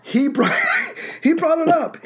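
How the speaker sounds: cracking up while telling it; laughing. Real, not acted. Laughs retelling friend's reaction.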